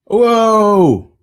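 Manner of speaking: be struck by the twist; real surprise. Real, not acted. Host reacting to the big reveal, amazed.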